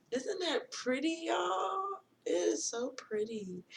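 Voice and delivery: high, slow coo of delight; gushing admiration. Real, not acted. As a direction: coo over it, drawn out and high-pitched. Gushing over her new crystal.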